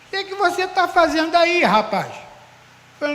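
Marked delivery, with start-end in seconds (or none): 0.1-2.1 loud, high, incredulous challenge; retold astonished question. Pastor spotting his passenger on foot, loud incredulous question. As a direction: call out in disbelief, voice raised. Pastor spots him walking.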